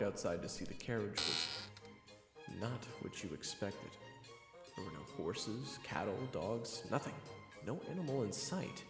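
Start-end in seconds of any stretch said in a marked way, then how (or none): none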